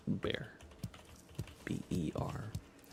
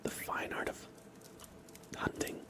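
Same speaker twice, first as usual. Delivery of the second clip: ASMR whisper; 12 semitones higher than usual. Real, not acted. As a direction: whisper close to the mic, unhurried.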